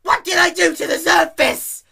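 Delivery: high, loud desperate wail; exasperated shouted plea; loud pleading. Acted. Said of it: "Dash pleaded".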